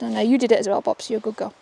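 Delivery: normal talking voice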